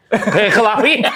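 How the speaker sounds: squealing the name through a laugh. Real, not acted. Man repeating his own joke name, laughing. Cracking himself up.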